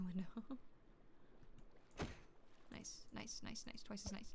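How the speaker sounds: soft whisper so the cat stays calm; breathy hushed whisper. Real, not acted. Whispering about cat at window.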